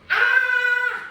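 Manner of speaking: high, loud full-throated scream; loud demo scream. Shown as a demonstration. Yoga teacher screaming along with the class. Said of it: "try it one more time".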